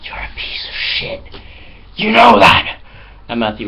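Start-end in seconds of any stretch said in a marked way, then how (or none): 0.0-1.2 slow, hissed threat under the breath; menacing whisper. Retold or imitated. The flatmate squaring up to Matthew, whispered menace. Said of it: "And he was like". 1.9-2.8 much higher and louder burst; sudden mock yell. The flatmate squaring up to Matthew, sudden yell. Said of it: "And he was like".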